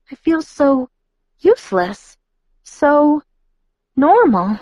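high, dramatic mock despair; mock horror. A narrator's reading. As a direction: shudder at the word normal. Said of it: "She cringed in horror of the word".

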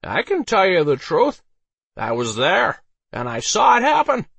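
put-on high, loud drunken voice; Harold the drunk, boasting. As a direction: boast like a tipsy eyewitness.

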